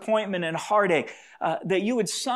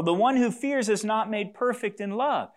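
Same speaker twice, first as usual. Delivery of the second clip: normal talking voice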